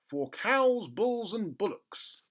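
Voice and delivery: high, slow official label voice; label read. Acted. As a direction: read it out like a pompous notice. Reading the label aloud.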